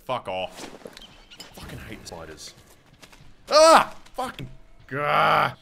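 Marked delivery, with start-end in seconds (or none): 3.5-3.9 sudden high yelp of fright; startled scream. Real, not acted. Startled by spider. 4.1-5.5 startled. Real, not acted. Spider jump scare.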